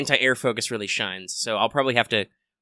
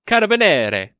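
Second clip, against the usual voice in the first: Carabinieri said with a hammed-up Italian lilt, rolled and sung out; Italian accent; 9 semitones higher, 5 dB louder than usual. Shown as a demonstration. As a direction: Carabinieri pronounced like a jolly Italian chef. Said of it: "Ah, mamma mia".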